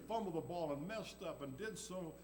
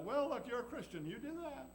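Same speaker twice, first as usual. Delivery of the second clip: higher, sneering taunt; devil taunting; 7 semitones higher than usual. Acted. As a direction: tease and accuse like the devil. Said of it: "The devil will say that".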